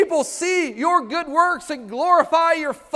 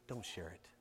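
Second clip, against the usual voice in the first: hushed, much softer tempting inner voice; inner voice whisper; 28 dB quieter than usual. Retold or imitated. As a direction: breathe it like a soft voice in your head. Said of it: "that voice that says".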